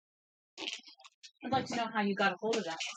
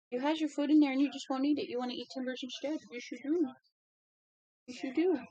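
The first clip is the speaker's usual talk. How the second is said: higher, sing-song pet talk; 7 semitones higher than usual. Real, not acted. Owner baby-talking to her dog about its food, higher. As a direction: coo at the dog teasingly.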